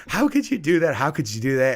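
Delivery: mock wounded reproach, not crying; imitated upset student. Student reproaches teacher after prank, imitated upset. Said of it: "she's not crying, but you can see her eyes are wet".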